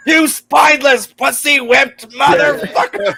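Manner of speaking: high-pitched shouted tirade, drawn out at the end; hammed-up furious quote; mock angry insult. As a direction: hurl it like the film character. Acting out the film's insult.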